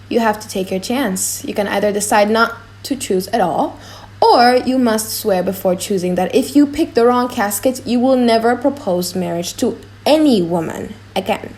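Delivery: usual speaking voice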